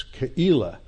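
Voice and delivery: Keilah said as kuh-EE-lah; short K sound, break, then EE-lah for Keilah; Hebrew pronunciation. Shown as a demonstration. Teacher summing up the Hebrew spelling, Keilah as kuh-EE-lah. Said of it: "But in Hebrew".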